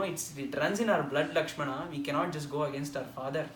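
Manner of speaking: normal talking voice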